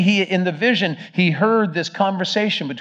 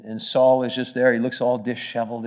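ordinary talk, nothing marked

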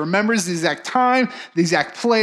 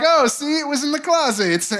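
high, falsely innocent; sing-song feigned innocence; fake casual; 8 semitones higher than usual. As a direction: pretend you just found it. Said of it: "so that she could be like".

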